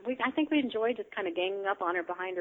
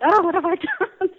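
laughing; 11 semitones higher, 12 dB louder than usual. Retold or imitated. Recalls panic, laughing.

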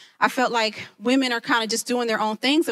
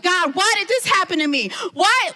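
mock tantrum; 7 semitones higher than usual. Complaining to God about her hardship, hammed-up whine. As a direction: throw a half-hearted tantrum.

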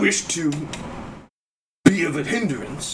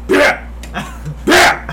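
rising, louder shouted name; calling out; 4 dB louder than usual. Acted. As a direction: yell across the dock. GM calls out to Pierre.

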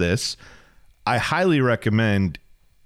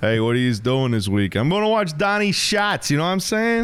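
hammed-up high New York accent; mock New York accent about watching Donnie Schatz; terrible New York accent; 4 semitones higher than usual. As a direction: play a brash New York guy in a bad New York accent. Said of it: "We're just doing terrible New York accents now".

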